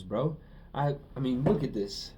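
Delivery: ordinary talk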